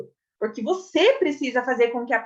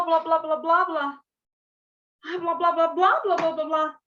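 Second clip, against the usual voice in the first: "blá, blá, blá" pushed louder and higher, urgent; expressive gibberish; 3 semitones higher, 3 dB louder than usual. Shown as a demonstration. Teacher acting a hurried woman in gibberish. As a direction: gibberish that sounds like someone running late. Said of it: "eu tenho que usar outros meios pra dizer".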